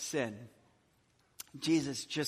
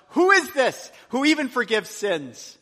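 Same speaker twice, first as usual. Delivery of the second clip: higher, louder, scandalized question; shocked murmur; 7 semitones higher, 9 dB louder than usual. Retold or imitated. Guests at the table questioning Jesus, scandalized. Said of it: "began to say among themselves".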